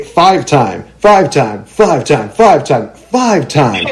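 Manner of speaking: stuck-record five-time, slowly repeated; host hamming up a list of titles; comic repetition. Pretend. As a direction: keep saying five-time like a skipping record.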